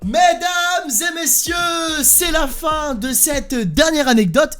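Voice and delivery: slow, grand announcer voice; mock-formal host. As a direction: present it like a ceremony. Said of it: "closing the story formally".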